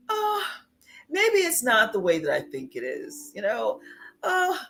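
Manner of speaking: high, sighed "oh"s, then tentative reasoning; mock second-guessing; wavering self-doubt. Voices the too-trusting heart's second thoughts.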